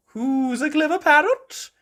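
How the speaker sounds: high, loud granny coo; Granny Pig. Acted. Granny Pig beaming at Polly, high cooing voice. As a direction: coax the pet in a high sing-song. Granny coos to her parrot.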